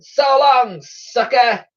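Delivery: high, loud taunt; gleeful high-pitched jeer; jeering shout. A narrator's reading. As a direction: shout a mocking farewell from a getaway van. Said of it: "the pair shouted".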